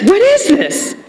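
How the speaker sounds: high, baffled friend asking what it is; surprised question. Retold or imitated. Friend holding up the bag, high and puzzled. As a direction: pitch it up high in disbelief.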